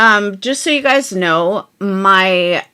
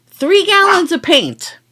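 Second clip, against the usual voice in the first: exasperated; 11 semitones higher, 3 dB louder than usual. Real, not acted. Exasperated repeat of paint complaint.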